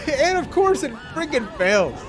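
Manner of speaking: high exasperated outcry, falling at the end; amused exasperation. Real, not acted. Player watching the chute fail, amused exasperation. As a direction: cry out in disbelief at the bad luck. Chute fails after yelling.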